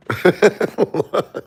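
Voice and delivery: high burst of laughter; laughing. Real, not acted. Man joking about Laura scaring him, high laughter.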